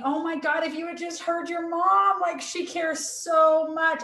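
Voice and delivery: high-pitched, drawn out on "so much"; emphatic reaction. Retold or imitated. Interviewer silently protesting to a child that mom cares, emphatic. Acted heartfelt thought about the mom.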